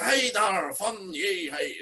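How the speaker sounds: put-on high drunken Belfast greeting; imitating a drunk man from Belfast; a drunk Belfast colleague greeting her backstage, Belfast accent. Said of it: "butchering this accent".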